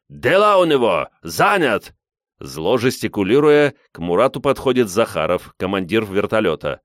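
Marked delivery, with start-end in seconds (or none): 0.1-1.1 higher, louder call; loud report over a crowd; relayed shout. A narrator's reading. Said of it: "кричит Мурату Абдул". 1.2-1.9 higher, raised call; short shout; shouted report. A narrator's reading. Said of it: "кричит Мурату Абдул".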